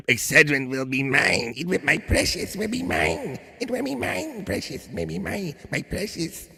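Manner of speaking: Gollum impression. Host doing Perry as Gollum for chat, hissing. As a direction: creep it out like Gollum coveting. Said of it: "do a Perry Gollum".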